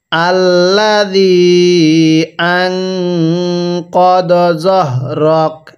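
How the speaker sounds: drawn-out chanted Arabic reading; slow Quran recitation. Shown as a demonstration. Teacher models Arabic recitation for learners, slow and drawn-out. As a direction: recite each syllable long and even, like a teacher modelling. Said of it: "এরকমভাবে শিখব।".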